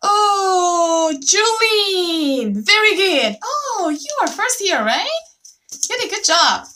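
high, loud delighted cheer; excited praise. Real, not acted. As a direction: celebrate the first right answer. Praising first correct student.

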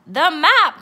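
high, very loud pirate cry; cried out. A narrator's reading. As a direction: cry out in alarm as captain. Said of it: "cried Braidbeard".

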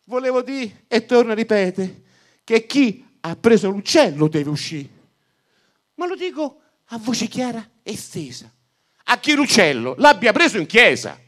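loud, high exasperated shout; priest shouting again. Acted. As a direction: yell the correction across the church. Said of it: "il prete rigridò".

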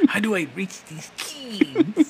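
high, slow, earnest teacher. Retold or imitated. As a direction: sigh it out like a worried teacher. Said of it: "he went full Jaime Escalante".

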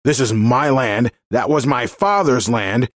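angry man. A narrator's reading. Landowner arguing back at a woman, angry. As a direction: defend your land with rising temper. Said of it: "he yelled".

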